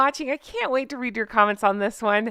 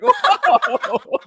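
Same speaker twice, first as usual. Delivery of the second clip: laughing whoa, much higher and louder; surprised; 12 semitones higher, 6 dB louder than usual. Retold or imitated. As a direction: react in shocked delight. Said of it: "I was like".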